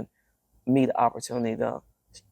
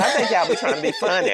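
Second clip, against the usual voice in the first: says it through a laugh, higher and louder; 9 semitones higher, 4 dB louder than usual. Real, not acted. Guest teasing the hosts about praising her singing, laughing.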